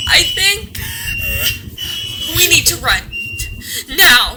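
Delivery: sudden alarm, get out now; panicked warning; urgent fear. Acted. Urging flight in danger.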